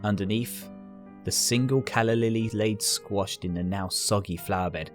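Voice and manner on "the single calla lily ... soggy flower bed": plain reading voice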